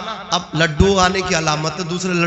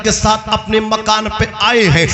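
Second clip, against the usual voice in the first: raised, driving sermon delivery; impassioned oratory pressing on without let-up; full-force preaching; 8 semitones higher, 4 dB louder than usual. Real, not acted. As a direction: preach in insistent, rhythmic phrases.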